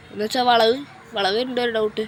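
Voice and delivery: usual speaking voice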